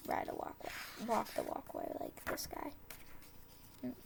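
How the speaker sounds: unvoiced quiet whisper; hushed aside about the guy; whispered comment. Real, not acted. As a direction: murmur it under your breath.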